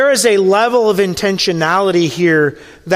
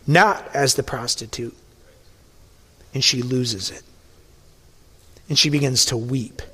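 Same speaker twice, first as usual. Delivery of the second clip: hushed tender telling; 6 semitones lower, 6 dB quieter than usual. Acted. Preacher telling how she loses it, hushed tender. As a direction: lower and softer, slowing into near hush.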